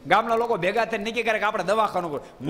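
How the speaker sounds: normal talking voice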